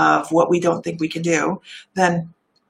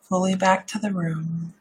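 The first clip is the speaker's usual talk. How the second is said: meditative voice. Shown as a demonstration. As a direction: ease them gently back to the room. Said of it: "let me get out of my meditative voice".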